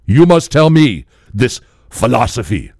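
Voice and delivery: earnest, insistent imitated African accent; in-depth African accent. Drunk African man demanding philosophy, earnest imitated accent. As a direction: press for wisdom in a mock African accent. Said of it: "it's just so in depth".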